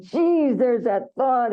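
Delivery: annoyed at catching the thought again; exasperated. Pretend. Mock frustrated self-talk.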